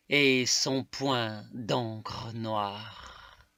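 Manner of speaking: emphatic declamation, slowing to the close; poem recitation. Acted. Reading Ibn Sal's poem to listeners, emphatic slow-ending recitation. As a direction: declaim it deliberately, drawing out the last phrases.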